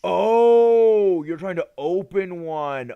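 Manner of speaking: high, loud sudden oh; surprised realization. Real, not acted. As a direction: realize it all at once.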